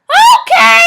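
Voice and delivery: overexcited agreement; hammed-up Naruto.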